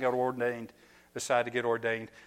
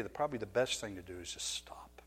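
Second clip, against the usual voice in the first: whispered advice; 4 semitones lower, 11 dB quieter than usual. Real, not acted. A preacher whispering advice to fathers. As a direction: drop to a whisper for the key advice.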